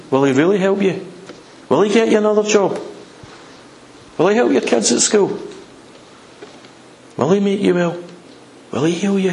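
probing questions. Acted. Devil questioning a believer about God's help, probing doubt. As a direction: sow doubt with each spaced question. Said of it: "he questions deep".

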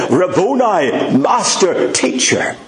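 high, slow joyful cry fading to a breath; a rising cry of delight that drops to a whisper; joyful recognition. Acted. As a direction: cry out his name in overjoyed recognition. Mary joyfully recognizes risen Christ.

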